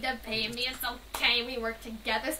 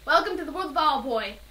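normal talking voice

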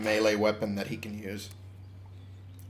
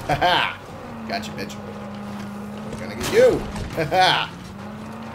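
excited; 9 semitones higher than usual. Real, not acted. Chasing an enemy in combat.

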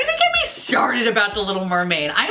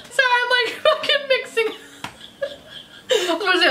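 laughing. Real, not acted. Laughing at own mixup.

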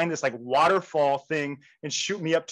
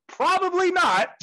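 high-pitched, teasing debunk; sing-out high, drawn first word; knowing debunk; 12 semitones higher, 4 dB louder, slower than usual. Acted. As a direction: pitch it way up, as if breaking news gently. Mocking hopes for next year.

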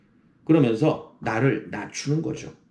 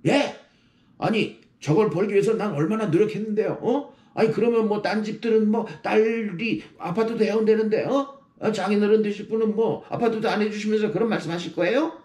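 높은 톤으로 억울하게 따지는 예비 신랑; 장인어른께 발끈해서 항변하듯; mock indignant groom; 8 semitones higher than usual. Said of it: "이렇게 해봤자". (장인 될 분께 욱해서 대드는 예비 신랑)

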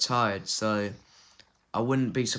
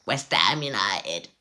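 put-on Cockney accent; 3 semitones lower, 3 dB louder, slower than usual. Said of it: "I tried to do a Cockney accent".

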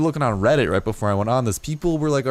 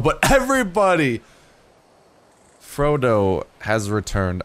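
grand announcement; 2 semitones higher than usual. Acted. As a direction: herald Frodo's return to the crowd. Grand announcement of pet's return.

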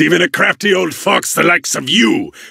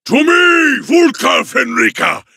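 rallying war cry; 10 semitones higher than usual. Acted. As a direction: summon your warriors with a roar. Rallying his warriors in battle.

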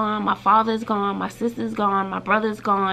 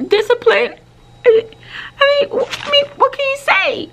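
much higher, swooning over each word; gushing delight; 4 dB louder than usual. Real, not acted. Teacher gushing to the camera over a student's praise, high and delighted. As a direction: melt over how sweet it is.

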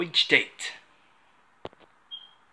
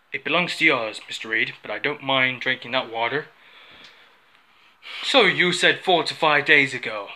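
ordinary talk

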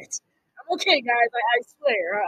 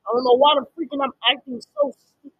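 usual speaking voice

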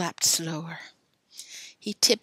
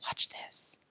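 breathy conspiratorial whisper; 14 dB quieter than usual. Acted. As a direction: whisper the plan. Billy plotting prank secretly.

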